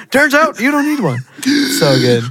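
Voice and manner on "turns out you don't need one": high, giddy repeat of the punchline; higher Bob quote. Retold or imitated. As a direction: push the line up high, delighted. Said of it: "He's like".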